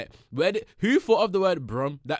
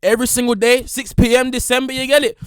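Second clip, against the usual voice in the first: loud, higher, pumped-up hype; hyped up; 7 semitones higher, 8 dB louder than usual. Real, not acted. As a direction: shout it to the fans like a hype man. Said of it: "I'm so hyped up".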